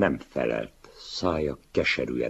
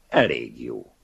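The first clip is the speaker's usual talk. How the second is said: cold verdict; 7 dB louder than usual. Acted. Young man coldly judging the older writer's book. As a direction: dismiss it coolly.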